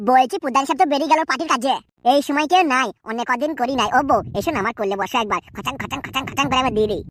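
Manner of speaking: coaxing husband. Acted. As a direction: coax the wife closer, low and sly. Husband coaxes wife.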